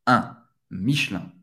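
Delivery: "un" as nasal ɛ̃, then "Michelin" ending the same; nasal IN sound. Shown as a demonstration. Teacher explaining the I-N spelling, nasal sound. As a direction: end "Michelin" through the nose, no sounded N. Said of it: "I N em francês".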